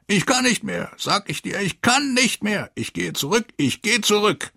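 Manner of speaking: high, frantic, repeated screaming; crazed shout. A narrator's reading. Broken lieutenant refuses to fight on, frantic high shout. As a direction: scream it like a madman who has snapped. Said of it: "schrie er".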